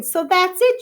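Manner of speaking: the penny drops, say it with joy; teacher suddenly understanding, exclaiming; delighted exclamation. Acted. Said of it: "she exclaimed".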